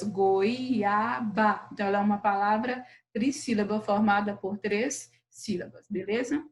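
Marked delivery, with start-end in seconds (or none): 0.0-1.7 "goiaba" in three beats, goi, a, ba; syllable by syllable. Shown as a demonstration. Teacher recapping three syllables, "goiaba" split up. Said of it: "onde nós temos aí três sílabas".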